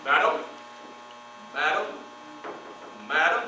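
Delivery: low, soft courtly bows; gentlemen greeting ladies. Retold or imitated. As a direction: tip your hat with each low madam. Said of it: "them going like this".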